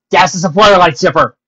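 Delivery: loud, high comic shout; mock outburst. Author's gag interrupting the story, loud mock outburst. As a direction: blurt it out loudly.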